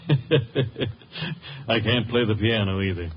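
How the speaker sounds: slow, amused laughing reply. Acted. Stevens laughing off the rich question. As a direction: laugh it off, unhurried.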